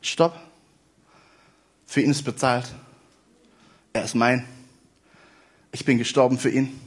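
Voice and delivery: Jesus defending the sinner at judgment, soft and low; tender Jesus voice. Retold or imitated. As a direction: speak for Jesus with quiet tenderness. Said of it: "Jesus aufstehen und sagen".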